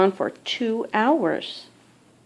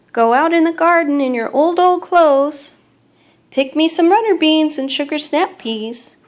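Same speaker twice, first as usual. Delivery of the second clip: higher, louder call across the garden; mother calling out; 7 semitones higher, 5 dB louder than usual. Acted. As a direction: call her child in from the garden, high and loud. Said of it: "I hear my mother calling".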